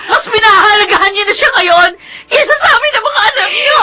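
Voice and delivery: high, tearful shouted reproach; crying shout. Acted. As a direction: throw the blame at mother, sobbing. Daughter shouts at mother in quarrel.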